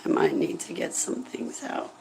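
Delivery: tear-choked, breathy whisper breaking high; barely able to speak through tears. Real, not acted.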